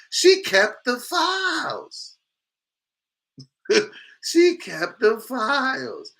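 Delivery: high, drawn-out incredulous cry; mock outrage. Streamer reacting to her keeping the files, mock outrage. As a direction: stretch every word high in disbelief.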